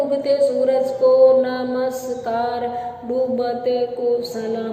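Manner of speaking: slow, dragged-out monotone. Shown as a demonstration. Teacher showing how the magician would drag if unhurried, very slow. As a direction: draw every word out slowly. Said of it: "मैं धीरे धीरे बोलूँ".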